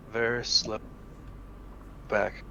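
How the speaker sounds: barely audible, halting caution; hushed warning. Acted. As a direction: warn a companion under your breath. Signals party to back away quietly.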